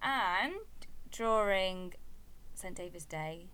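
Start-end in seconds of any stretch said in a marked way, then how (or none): 1.1-1.9 during said as tjoring with a rolled r; during with a Welsh tap on the r. Shown as a demonstration. Mother teaching son how to say during, careful Welsh pronunciation. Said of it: "not drawing, but during".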